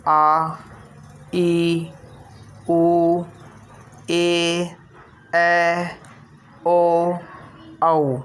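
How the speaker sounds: each vowel आ ई उ ए ऐ ओ औ held for double length; long vowels drawn out. Shown as a demonstration. Teacher showing students the long vowels, each held twice as long. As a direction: stretch every vowel twice as long as a short one. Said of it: "ये दीर्घ स्वर है ... उससे double समय लग रहा है".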